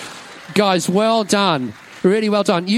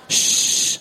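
long unvoiced "shh"; drawn-out shush. Real, not acted. Hushing children before an answer.

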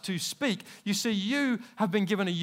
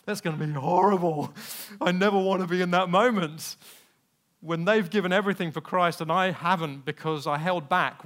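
loud, intense self-talk about the dreaded moment; loud, dramatic voicing of inner fear; 7 dB louder than usual. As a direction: push it out with full conviction. Inner resolve in the vision.

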